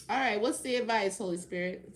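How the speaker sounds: usual speaking voice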